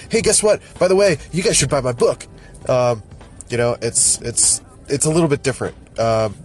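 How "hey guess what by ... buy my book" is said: sudden sales pitch. Retold or imitated. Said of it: "all of a sudden they're like".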